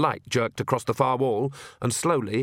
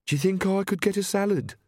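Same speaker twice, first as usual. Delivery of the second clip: higher-pitched hesitant question; tentative venture; 8 semitones higher than usual. A narrator's reading. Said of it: "she ventured".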